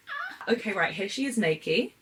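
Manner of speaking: usual speaking voice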